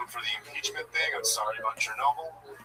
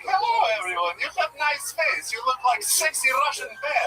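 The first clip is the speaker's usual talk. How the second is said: put-on Russian accent inner voice; 13 semitones higher, 5 dB louder than usual. Comic voicing his own inner voice, mock Russian accent. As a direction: ham up a broken-English Russian accent. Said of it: "my inner voice in here is just like".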